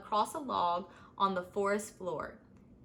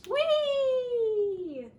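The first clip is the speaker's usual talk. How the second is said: high, loud joyous whoop; a long high sung-out whee; gleeful whee; 6 dB louder than usual. Acted. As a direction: squeal with delight as you swing. Snake lets go, swinging.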